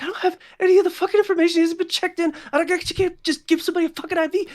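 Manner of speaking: very high, fast, loud protest; frantic nurse. Acted. Nurse to gunman about missing information, frantic. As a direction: rush the excuses in a high frantic voice. Panicked nurse held at gunpoint.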